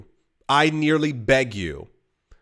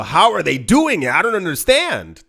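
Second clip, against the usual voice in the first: higher, louder, puzzled mock whine; mock bewilderment; 5 semitones higher, 4 dB louder than usual. Consumer puzzled by rich companies, mock bewildered. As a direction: play dumb about where the profits come from. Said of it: "and you go".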